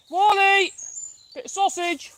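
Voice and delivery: high, sharp call with a treat offer; calling a dog. Real, not acted. Calling Wally over for sausage, calling out.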